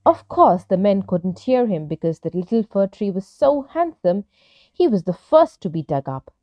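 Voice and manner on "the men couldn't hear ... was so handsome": ordinary delivery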